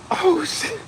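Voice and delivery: high squeal of surprise; shocked. Retold or imitated. Kid in cafeteria, shocked squeal. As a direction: react like the milk just hit. Reacting to milk flying.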